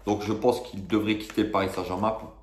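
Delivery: normal talking voice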